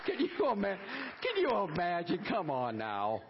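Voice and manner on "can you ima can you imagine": high laugh breaking into words; tickled, laughing through the question. Real, not acted. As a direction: crack up while talking. Laughs at his own joke.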